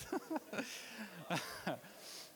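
quiet fading chuckle; laughter fading out; soft trailing laugh. Real, not acted. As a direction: let the laugh die down. Laughs at own pun.

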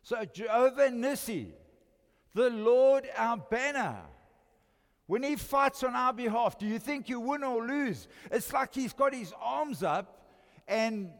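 loud, high preaching with the arms up; preaching at full force. Acted. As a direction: proclaim it to the whole church.

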